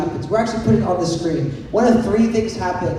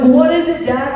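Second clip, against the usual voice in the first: put-on whiny kid question; 7 semitones higher, 5 dB louder than usual. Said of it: "I'm like".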